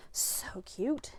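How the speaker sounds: soft breathy whisper to herself; whispered so cute over a craft card; whispered aside. Real, not acted. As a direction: breathe it out as a private aww.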